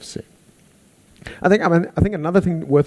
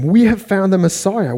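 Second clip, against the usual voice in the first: lightly voiced eager brother, pitch up; excited announcement; 7 semitones higher, 3 dB louder than usual. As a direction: tell it like the best news ever, raised voice. Andrew excited to his brother.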